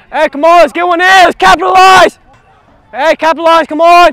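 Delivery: loud shout across the pitch; rallying yell to teammates. Real, not acted. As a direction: call out to your teammates. Player yelling to teammates.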